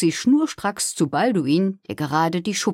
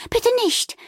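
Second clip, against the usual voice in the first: very high-pitched plea; pleading cry. A narrator's reading. Fiona begs Amalie not to fly, pleading. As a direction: squeal a worried plea. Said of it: "rief Fiona".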